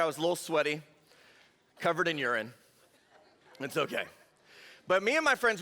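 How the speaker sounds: ordinary talk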